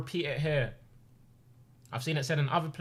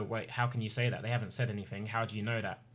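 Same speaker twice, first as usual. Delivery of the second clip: mock doubting quote; 6 semitones lower, 6 dB quieter than usual. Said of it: "You go".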